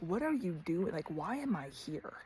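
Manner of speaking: asking under her breath, unsure; quiet uneasy questions. Retold or imitated. Anxious questions to God on drive.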